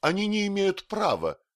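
very high, loud outcry; shrieked objection, pitch far up; indignant protest. A narrator's reading. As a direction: protest frantically at the injustice. Frantic protest against furniture seizure.

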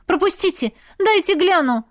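high, urgent shout; desperate high exclamation; crying out. A narrator's reading. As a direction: call out to push through the crowd. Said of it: "выкрикнула я".

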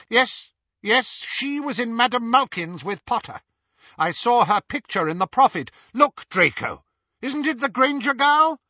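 high, loud, urgent woman's voice; pressing and thrilled, pitched high; excited recognition. A narrator's reading. Narcissa excitedly recognises the prisoner.

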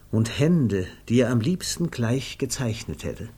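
ordinary delivery

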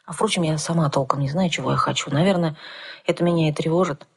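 ordinary delivery, nothing marked

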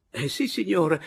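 high, loud, obliging yes; eager old man. Acted. Old man to prospective lodger, eagerly obliging. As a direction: answer eagerly, pitch and volume up.

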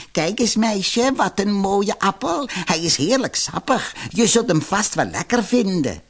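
high, wheedling crone; falsely kind grandmother voice, coaxing; disguised old woman. A narrator's reading. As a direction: tempt the girl with put-on sweetness. Disguised villainess tempts with apple.

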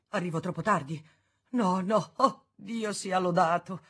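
relieved exclamation. A narrator's reading. Arnoux arriving, relieved.

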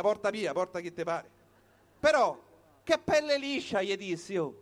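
ordinary talk

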